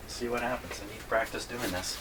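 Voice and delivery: ordinary delivery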